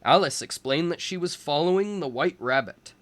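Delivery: ordinary delivery, nothing marked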